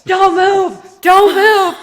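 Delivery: high, loud acted warning shouts; retold screaming. Strangers warning her in the pool, imitated screaming. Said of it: "start screaming at me".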